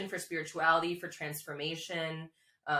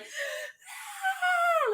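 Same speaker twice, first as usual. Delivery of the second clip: short high cry of anguish; retold scream; 6 dB louder than usual. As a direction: throw out a sudden high scream as if reliving it. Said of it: "Like, screaming".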